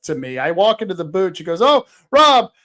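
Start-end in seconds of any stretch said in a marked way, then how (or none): 1.6-2.5 higher, louder mock hello; put-on big hello, pitched up; imitated effusive greeting. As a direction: greet as if meeting for the first time, loudly. Retold gushing booth greeting.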